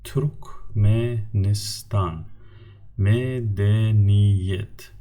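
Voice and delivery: Turkmenistan and medeniyet drawn out very slowly; very slow teacher model; slow model words. Shown as a demonstration. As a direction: stretch each syllable for learners. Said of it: "the words with the four syllables".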